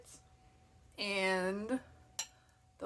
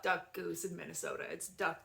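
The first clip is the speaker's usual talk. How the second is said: usual speaking voice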